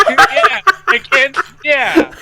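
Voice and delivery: high, laughing yeah; squeaky high giggling exclamation. Real, not acted. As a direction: crack up at the absurd pairing.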